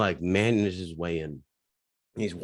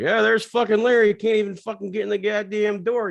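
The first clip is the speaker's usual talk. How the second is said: high, loud jeering voice; mocking barflies; 12 semitones higher, 5 dB louder than usual. The six regulars jeering at Larry, high and loud. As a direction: crow about Larry like a rowdy regular. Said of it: "all six guys like".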